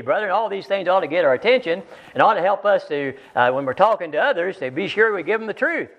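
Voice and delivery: ordinary talk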